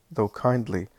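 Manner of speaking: ordinary delivery, nothing marked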